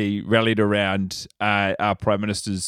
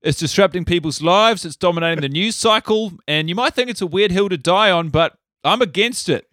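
higher, pompous contrarian declaration; mock bravery; 6 semitones higher than usual. Guest mock-bravely declaring he is against covid. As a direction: announce the obvious like a daring stand.

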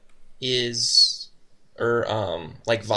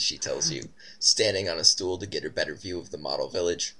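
ordinary talk

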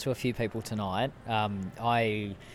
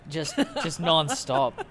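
laughing; 6 semitones higher, 5 dB louder, much slower than usual. Real, not acted. Laughing about talking nonstop.

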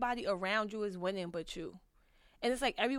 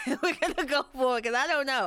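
loud laughing admission, much louder; 5 semitones higher, 10 dB louder than usual. Real, not acted. Admitting her advice was bad, laughing loudly. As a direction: laugh through it at your own bad advice.

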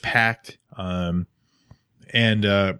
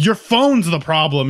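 defiant retort; 6 semitones higher, 4 dB louder than usual. Retold or imitated. Retold retort in confrontation.

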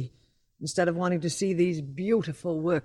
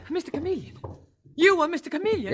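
stunned, much higher disbelief; shocked; 11 semitones higher, faster than usual. Acted. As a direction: gasp it out in disbelief. Curator shocked at reveal.

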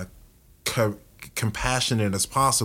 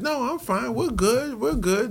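much higher, breezy mock reassurance; joking brush-off; 14 semitones higher than usual. Husband brushing off his wife's worry, joking and high. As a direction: toss it off lightly as a joke. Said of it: "jokingly said".

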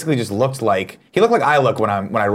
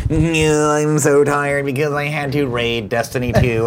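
slow, whiny mock gamer voice; lightly voiced imitation of a gamer; mocking impression; 2 semitones higher, slower than usual. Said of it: "you came in and were like".

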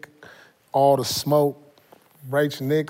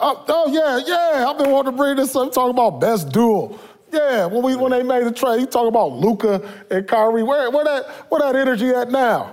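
excited; 7 semitones higher than usual. Real, not acted. Excited jab at Mavericks.

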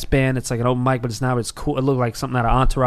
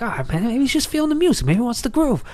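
much higher, lightly voiced buddy; imitating friend, much higher; 10 semitones higher than usual. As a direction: pitch way up as the buddy. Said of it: "I was like".

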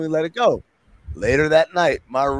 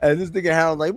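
usual speaking voice

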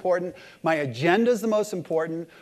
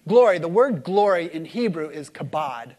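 ordinary talk

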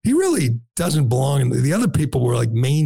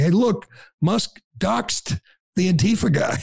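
higher-pitched mocking quote; mock outcry; 6 semitones higher than usual. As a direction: parrot their claim in mock alarm. Mocking the other side's claim.

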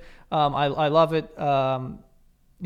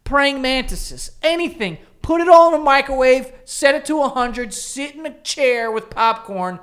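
higher and louder, slowing on the list; rising gleeful rant; 7 semitones higher, 6 dB louder than usual. Real, not acted. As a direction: build a gleeful, cruel list. Gleeful insect microwave rant.

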